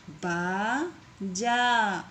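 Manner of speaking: बाजा stretched very slowly, syllable by syllable. Shown as a demonstration. Teacher to pupils, sounding out बाजा very slowly. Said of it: "इस तरीके से आपको शब्दों को पढ़ना है".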